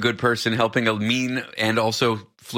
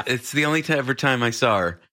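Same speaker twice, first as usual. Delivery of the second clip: ordinary delivery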